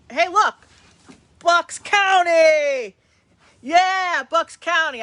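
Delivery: thrilled greeting to a Bucks County viewer; excited drawn-out shout. Real, not acted. Excited at hometown comment.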